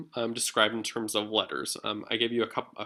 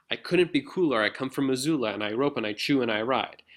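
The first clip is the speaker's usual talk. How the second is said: lilting galloping beat, each line pulling into the next; teacher demonstrating a sing-song meter; sing-song rhythm. Shown as a demonstration. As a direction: read it like a gallop. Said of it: "we hear a really sing-songy rhythm".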